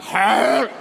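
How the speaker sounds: a high, loud squawked "Bah!"; duck squawk. Retold or imitated. Duck squawks when grabbed.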